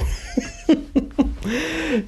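a sudden high burst of laughter. Real, not acted. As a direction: laugh at your own story. Laughing at his own story.

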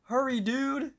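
high-pitched mock yell, not very loud; mock scream. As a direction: call out urgently up high, imitating a scream. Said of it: "Mordecai screams out".

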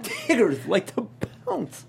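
high slow bouncy Tigger voice. Pretend. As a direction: stretch it out like Tigger. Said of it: "Tigger impression".

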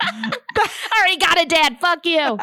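put-on sass him like a defiant six-year-old; cheeky kid. Kid snapping at mom's fiance, mock bratty and high.

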